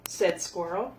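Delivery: plain reading voice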